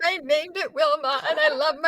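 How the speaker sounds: hammed-up grief for a beloved tooth; mock distress. Patient grieving her named tooth, mock distress.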